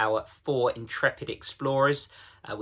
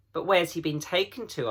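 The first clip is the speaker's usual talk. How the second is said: put-on higher girl's voice, anxious; a young girl pressing for news; lightly voiced worried child; Lucy asking; 6 semitones higher than usual. A narrator's reading. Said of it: "asked Lucy".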